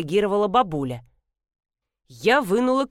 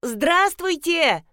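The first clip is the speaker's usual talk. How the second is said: high, drawn-out mock yell; yelled greeting; 9 semitones higher, much slower than usual. A narrator's reading. Customer trying to rouse the dozing granny, yelling. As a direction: stretch the greeting high to wake someone. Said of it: "заорала я".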